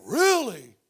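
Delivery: loud, swooping mock-shocked question; exaggerated incredulous outburst; acted disbelief. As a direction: react like you just heard gossip. Acts out foolish hasty reaction.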